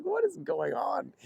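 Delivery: high-pitched, drawn-out bewilderment; baffled outcry. Retold or imitated. Dad recounting his reaction to a kid's antics, baffled. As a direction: slowly squeak out the disbelief, voice way up.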